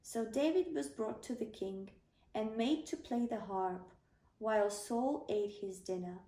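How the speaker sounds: plain reading voice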